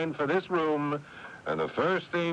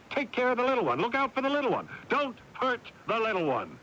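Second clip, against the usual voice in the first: much higher, sing-song mock of old family orders; mocking parental orders; 12 semitones higher, faster than usual. Brother mocking the lines drilled into him as a child, sing-song. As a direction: parrot the nagging he grew up with. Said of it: "Had that drummed into my ears".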